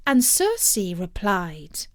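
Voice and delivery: ordinary delivery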